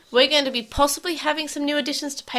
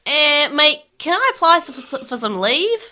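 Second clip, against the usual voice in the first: hammed-up Australian accent, higher and louder; mock Australian accent; 5 semitones higher, 5 dB louder, slower than usual. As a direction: lay on a broad Australian accent, cheekily. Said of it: "I'm doing Australian".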